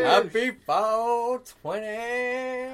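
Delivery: drawn-out high loud cheer; slow hype shout. Acted. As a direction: stretch it out like a party host. Show intro hype.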